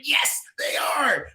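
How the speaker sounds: drawn-out high, loud shout; yelling. Retold or imitated. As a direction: yell it back at the movie screen, stretched out. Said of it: "I'm yelling at the theater".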